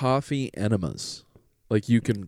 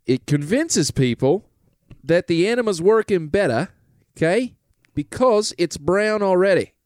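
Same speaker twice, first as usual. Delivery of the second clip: hammed-up British pitch to the lads; British salesman; 3 semitones higher than usual. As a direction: sell it like a cheeky British huckster. Plays a British Goop salesman.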